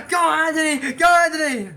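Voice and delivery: high, loud cheering; loud high cheering yell; cheering shout. Retold or imitated. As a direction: cheer him on from the stands. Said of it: "She's cheering".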